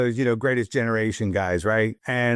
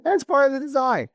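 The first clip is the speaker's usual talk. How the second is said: defensive; 14 semitones higher, faster than usual. Retold or imitated. Retold own proud answer.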